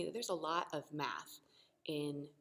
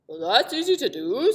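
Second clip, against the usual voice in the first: louder, oddly timbred magic voice; shapeshifter voice; 5 semitones higher, 14 dB louder than usual. Acted. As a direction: grant it grandly.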